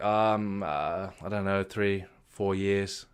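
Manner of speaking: quiet, slow, hesitant mumble; sheepish mumble. Retold or imitated. To his mentor, admitting his habit in a quiet, sheepish mumble. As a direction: drag out a guilty, halting answer.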